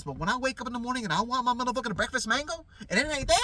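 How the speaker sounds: usual speaking voice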